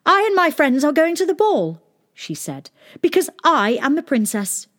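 high, declaiming princess; proud announcement. Acted. Princess answers palace guards at the gate, proudly declaring.

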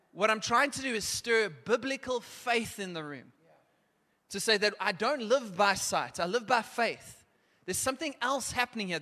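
fervent preacher stirring the room to faith; full-force preaching. Acted. Youth pastor rallying young people, fervent preaching.

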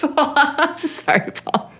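high, slow, giggling through words; can barely say it for laughing; laughing apology. Real, not acted.

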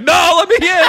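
high, loud, pleading cry; mock panic. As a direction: beg to be let in, hammed up. Kid locked out, begging.

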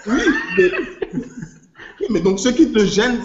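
high laugh, words squeezed through it; real laughter over the words; laughing while talking. Real, not acted. Amused by the Kirikou line, laughing through words.

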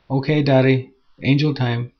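an eager young daughter, lightly voiced; a hint of a small girl asking her dad; little girl.

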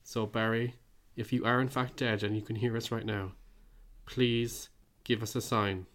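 usual speaking voice

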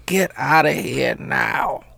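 usual speaking voice

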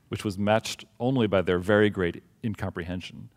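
ordinary talk, nothing marked

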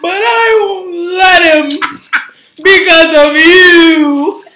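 loud, tearful, slow hammed-up breakup speech; dramatic monologue. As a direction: wail it like a stage heroine leaving a man. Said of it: "reciting that monologue".

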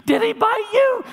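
high, frantic cry from the bedroom; a mother's panicked shout of worry; panicked wife. Retold or imitated. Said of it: "and I hear Tracy".

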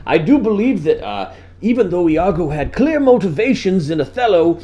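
mock literary debater; pompous young scholar. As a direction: affect a learned tone. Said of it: "I'd be like".

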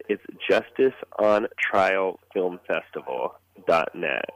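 slow and clear, spelling out the web address. Shown as a demonstration. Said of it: "I'll say it slower".

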